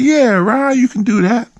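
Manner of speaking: very high-pitched, upbeat yes; eager agreement. Retold or imitated. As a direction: agree readily, voice way up. Retold agreeing reply to Ron.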